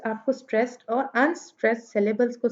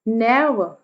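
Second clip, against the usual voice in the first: Never with strong ने, falling to light वर; trochaic stress; 2 semitones lower, much slower than usual. Shown as a demonstration. Teacher gives trochee example, first syllable of never stressed. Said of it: "पहले वाला syllable जो है वो stress आ रहा है".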